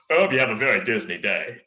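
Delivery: loud, hearty cartoon henchman voice; Kronk impression. Shown as a demonstration. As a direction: belt it out as Kronk. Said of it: "It's Kronk's voice, but Kronk's not saying it".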